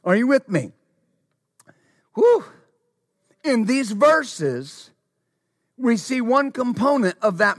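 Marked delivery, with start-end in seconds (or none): 2.1-2.4 a sharp high whistle-like "whew"; quick high-pitched whew. Real, not acted. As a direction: blow out a high excited whew. Preacher exhales excitedly.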